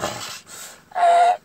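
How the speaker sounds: very high, loud squeal; vocal burnout squeal; tire screech imitation. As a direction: screech like tires burning rubber. Imitates tires burning rubber.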